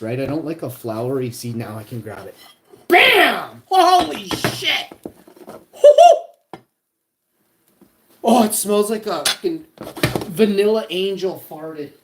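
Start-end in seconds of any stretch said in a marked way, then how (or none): 2.9-3.6 sudden loud yelled bam; a real burst of triumph; excited shout. Real, not acted. Excited cooking reveal. 3.6-4.9 slow, high, loud shout of awe; real stunned delight; amazed yell. Real, not acted. Excited cooking reveal. 5.0-6.3 high loud squealing laugh; real giddy shriek of laughter. Real, not acted. Excited cooking reveal. 8.2-11.9 excited. Real, not acted. Excited cooking reveal.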